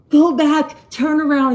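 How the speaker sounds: high panicked shout, imitating him; retold freaking out. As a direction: mimic his frantic yell to turn back. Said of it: "He starts freaking out".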